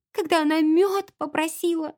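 high, plaintive explanation; tearful. A narrator's reading. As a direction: explain as if sobbing a little. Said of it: "всхлипнула Энн". (плаксиво, высоким голосом)

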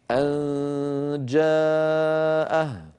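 أن جاءه very slowly, the nasal held before a firm جيم; model recitation. Shown as a demonstration. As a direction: hum the nasal of أن long, then close firmly on جاءه. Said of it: "الجيم من وسط اللسان".

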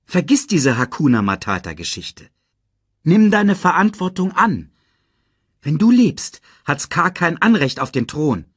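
a hint of an insistent lioness; lightly voiced urging Nala. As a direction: push him to take responsibility. Nala urges Simba.

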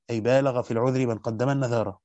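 usual speaking voice

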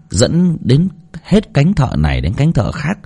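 ordinary talk, nothing marked

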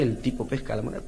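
normal talking voice

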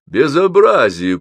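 loud, high, drawn-out declamation; trained, projected voice. A narrator's reading. Добролюбов complains about the noisy writers, projected voice. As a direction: declaim your outrage like an orator. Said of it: "произнёс он поставленным голосом".

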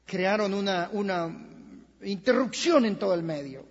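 usual speaking voice

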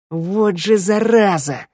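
high, drawn-out shout; outraged yell. A narrator's reading. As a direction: yell the curse slowly, pitch up. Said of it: "заорала Шныряла".

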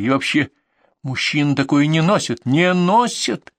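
high-pitched, indignant scolding; angry outburst. Acted. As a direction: lay into him with mounting disgust. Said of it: "От злости у меня сорвался голос". (злобно, срываясь на высокий голос)

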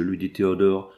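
ordinary delivery, nothing marked

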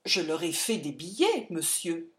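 composed, dignified reply. A narrator's reading. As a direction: answer with wounded dignity, voice held down. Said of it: "dis-je d'un air digne".

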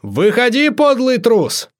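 high, drawn-out taunting yell; loud call-out, pitch climbing high; yelling challenge. A narrator's reading. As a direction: holler for the coward to come out. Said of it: "заорал Амадей".

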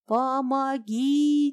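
slow, high desperate cry; screaming for help. A narrator's reading. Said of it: "закричала она".

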